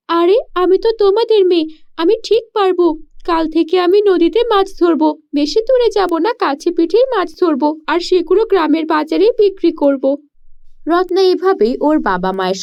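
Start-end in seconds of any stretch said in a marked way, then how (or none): 0.1-10.0 put-on higher, eager girl's voice; a poor village girl puzzling aloud; young girl voice. Daughter asks her parents why, in a higher girl's voice.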